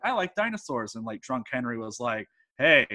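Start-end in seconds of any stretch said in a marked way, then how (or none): none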